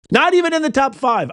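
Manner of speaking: "Not even" jumps high, falling through "five"; emphatic repeat. Real, not acted. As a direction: hit "Not even" high in indignant insistence. Host insists on his ranking.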